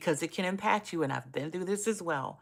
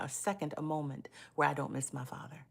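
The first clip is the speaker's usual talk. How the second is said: real whisper; 5 dB quieter than usual. Real, not acted. Missing her late father.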